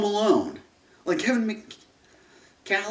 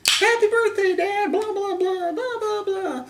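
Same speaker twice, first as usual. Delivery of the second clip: mock chirpy greeting; 3 dB louder than usual. Mocking movie dialogue.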